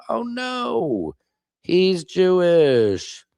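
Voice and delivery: high, slow fake gasp of alarm; hammed-up mock shock; mock horror. Mock horror at being outed.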